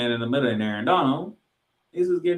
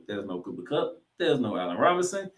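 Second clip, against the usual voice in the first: ordinary delivery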